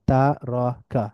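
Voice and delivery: তারাকা as three separate beats, ta, ro, ka; syllable by syllable. Shown as a demonstration. Teacher breaking down the word, তারাকা syllable by syllable. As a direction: split তারাকা slowly into syllables. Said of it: "একটু মিলিয়ে মিলিয়ে পড়ব".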